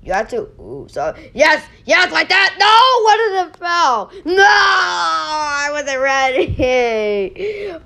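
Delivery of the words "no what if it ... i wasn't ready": despairing cry. Real, not acted. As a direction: cry out that you weren't ready. Tower falling, kid despairs.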